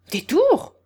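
high, loud one-word retort; indignant questioning snap; curt. A narrator's reading. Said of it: "d'un ton sec".

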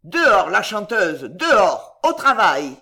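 loud, forceful commands; shouted order. A narrator's reading. Belgodère driving the singer out to work, loud orders. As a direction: drive her out with punched words. Brute orders weeping girl out.

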